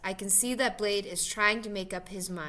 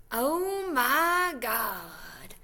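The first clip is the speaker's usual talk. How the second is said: very drawn-out, higher exclamation of amazement; excited whoop; 4 semitones higher, much slower than usual. A narrator's reading. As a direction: stretch each word out, amazed but not shrieking. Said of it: "he's shrieking and laughing and whooping it up so loud".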